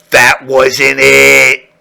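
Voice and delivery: very slow, menacing stomach; slow, ominous warning. Acted. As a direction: drag it out like a threat.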